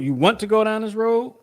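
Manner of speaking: retold question, pitched high and rising at the end. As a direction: ask it back, lifting sharply toward the end. Acting out a pointed question from conversation.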